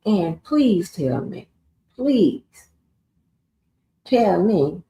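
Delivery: slow, drawn-out please tell me, the second please pitched high; drawn-out please, the second one up high; emphatic please. Real, not acted. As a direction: plead with mock patience, leaning on please. Demanding an answer, indignant.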